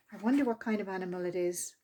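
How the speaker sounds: curious hushed murmur; quiet quick wonder. Acted. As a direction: hush it like a secret aside. Cub whispers curiously.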